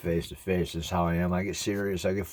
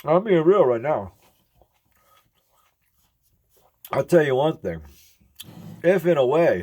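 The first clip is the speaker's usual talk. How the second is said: raised voice, insistent; loud emphatic; 8 semitones higher, 7 dB louder than usual. Real, not acted. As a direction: push it out loud. Emphatic statement.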